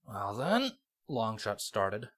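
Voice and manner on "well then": wry, smirking quip. Acted. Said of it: "a smirk on his face".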